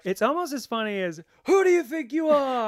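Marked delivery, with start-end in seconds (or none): 1.4-2.7 much higher and louder shouted put-on voice; mock indignant shout. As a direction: shout it like a diva's outraged challenge. Quoting famous outraged line.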